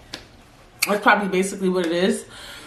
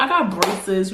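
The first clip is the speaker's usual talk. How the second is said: normal talking voice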